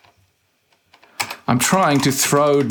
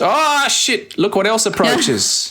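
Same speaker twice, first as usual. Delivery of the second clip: sudden alarmed outburst, pitched up; 10 semitones higher, slower than usual. Real, not acted. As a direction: react to a monster appearing. Sudden enemy appears.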